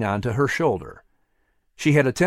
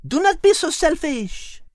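much higher, sharp scolding. Acted. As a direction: scold the selfish cat. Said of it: "Liz scolded".

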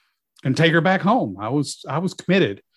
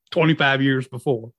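ordinary talk, nothing marked

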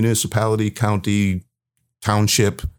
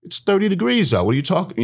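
incredulous; 11 semitones higher, faster than usual. Retold or imitated. Exasperated at being told hands out.

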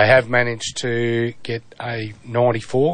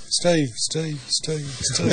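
echo; 5 semitones higher, much slower than usual. Pretend. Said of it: "He could have called you his Echo".

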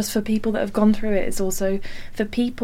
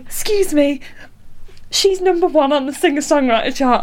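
excited announcement; 6 semitones higher, 4 dB louder than usual. Retold or imitated. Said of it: "she was like".

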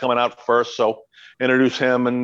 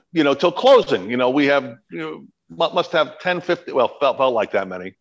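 ordinary delivery

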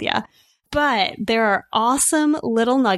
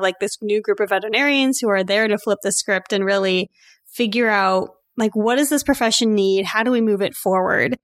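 usual speaking voice

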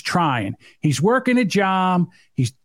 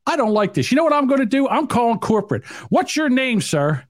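outraged customer; 7 semitones higher than usual. Pretend. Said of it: "I'll pull up".

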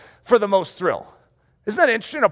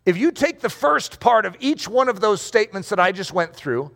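ordinary delivery

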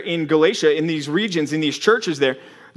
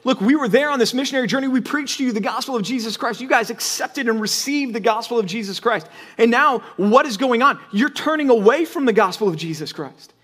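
higher and faster, disbelief mounting; a preacher voicing Paul scolding the Galatians, rising in disbelief; astonished Paul; 6 semitones higher than usual. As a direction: voice Paul as if he can't believe the news. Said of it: "He's going".

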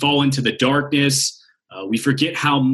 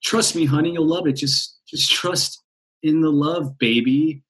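higher, hushed coaxing with a whispered slow just trust; coaxing heart; 4 semitones higher than usual. Retold or imitated. Heart coaxes the mind to trust, hushed mock tender voice. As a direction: sweet-talk the panicking partner, half-mock. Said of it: "And your heart's like".

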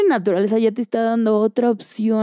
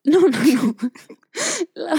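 laughing; 7 semitones higher, slower than usual. Real, not acted. Laughing denial.

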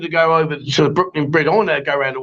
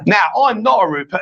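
ordinary delivery

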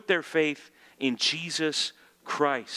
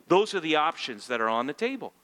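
normal talking voice

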